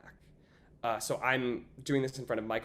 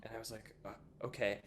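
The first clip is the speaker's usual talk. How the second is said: whisper; 4 semitones lower, 13 dB quieter, faster than usual. Real, not acted.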